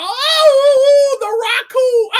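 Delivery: long, high loud howl into the words; imitated howling. Host mimics howling woman on TV show, mock howling. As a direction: wail it out, mock-anguished. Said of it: "but she was like".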